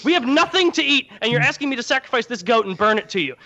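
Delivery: a poor man losing his only goat, hammed up; exasperated rant. Mano outraged over his only goat.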